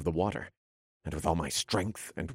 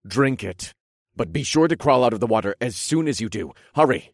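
loud, fast, rising urgency; urgent shout; 5 semitones higher, 6 dB louder, faster than usual. A narrator's reading. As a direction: push them to hurry. Urgent warning to sirens.

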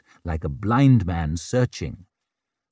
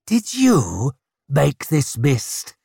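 slow, higher snarled demand; snarling; 4 semitones higher, slower than usual. A narrator's reading. Warrior confronts the old woman about mist, snarling. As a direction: snarl it slowly, word by word. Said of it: "he snarled at the woman".

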